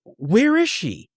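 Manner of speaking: high, frantic shock, acted out; retold scream. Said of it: "like, she screamed".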